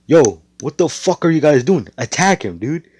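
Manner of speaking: villain yelling at his mages to attack; mock frantic order. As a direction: yell at the mages to get moving.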